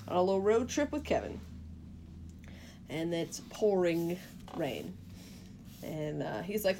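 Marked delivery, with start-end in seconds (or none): none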